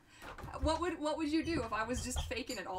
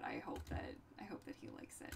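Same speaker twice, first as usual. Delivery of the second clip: secret whisper, much softer; 14 semitones lower, 9 dB quieter than usual. Real, not acted. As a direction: whisper it so he won't hear. Secret gift for Eric.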